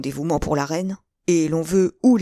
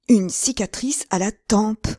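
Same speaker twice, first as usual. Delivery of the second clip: "Une cicatrice à la tempe!" cried out higher and louder, slowed; startled exclamation; 5 semitones higher, 4 dB louder, slower than usual. Acted. D'Artagnan recognizing the scarred man, crying out "Une cicatrice à la tempe!". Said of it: "s'écria d'Artagnan".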